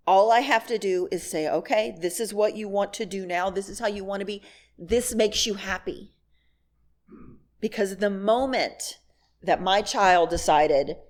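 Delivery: usual speaking voice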